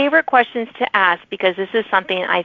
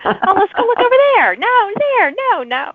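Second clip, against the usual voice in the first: high, fast, scatterbrained squeal; higher excited mock chatter; mock distraction; 12 semitones higher than usual. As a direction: play the easily distracted writer. Mimicking distracted writer chasing squirrels.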